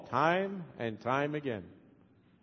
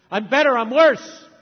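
mock self-comparison; 7 semitones higher, 9 dB louder than usual. Mimicking self-comparison chatter.

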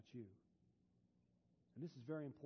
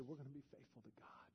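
whisper; 9 dB quieter than usual. Retold or imitated. Quoting grieving family.

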